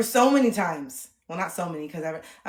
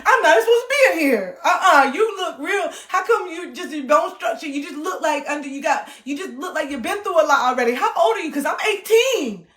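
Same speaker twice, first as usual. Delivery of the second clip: high loud protesting voice; loud shrill pleading protest; defensive whine; 7 semitones higher, 8 dB louder than usual. Pretend. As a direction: insist you're a child. Arguing back as young self.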